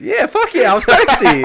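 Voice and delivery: high and loud, laughing through the words. Real, not acted. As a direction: crack up while admitting it.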